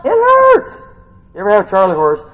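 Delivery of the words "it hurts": very high, loud exclamation; comic high-pitched yell of pain; hammed-up pain cry. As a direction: wail it in mock agony. Exclaiming about cramp pain.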